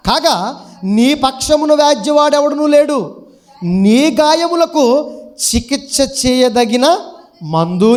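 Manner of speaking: loud, slow proclamation; forceful scripture reading. Acted. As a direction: declaim God's judgement to the whole congregation. Reads scripture aloud.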